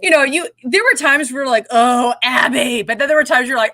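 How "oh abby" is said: drawn-out, slow "Oh, Abby"; slow, let-down reaction; exasperated groan. Acted. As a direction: sigh it out slowly, as at someone's antics. Contrasts two reactions to her.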